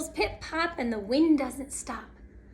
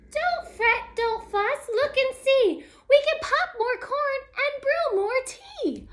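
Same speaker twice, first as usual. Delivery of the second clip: high, squeaky little mouse voice; high storybook mouse; 9 semitones higher than usual. Acted. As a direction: reassure in a tiny mouse's high pitch. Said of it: "Mouse squeaks".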